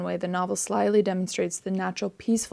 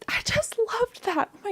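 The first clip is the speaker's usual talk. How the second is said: gushing whisper. Real, not acted. Gushing over a beautiful quote.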